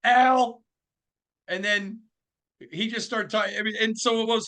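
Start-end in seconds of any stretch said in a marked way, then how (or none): 0.0-0.6 loud, high one-word correction; Al Davis impression. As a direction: imitate him cutting in, high and loud. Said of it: "He went".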